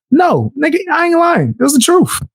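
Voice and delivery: high, indignant outburst; real high-pitched protest; heated denial. Real, not acted. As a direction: snap back that you're not lying. Angry denial of lying.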